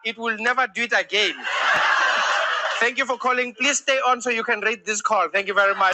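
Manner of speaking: lightly played unhelpful dispatcher; South African operator. Operator closes a call about a stuck cat, South African operator voice. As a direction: deliver tough advice, then the formal sign-off. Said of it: "if you had South African operators".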